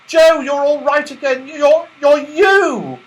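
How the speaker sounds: high, loud, slowing joyful cry; delighted cry. A narrator's reading. Beth seeing Joe restored, delighted cry. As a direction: cry out in happy disbelief. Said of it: "cried Beth in delight".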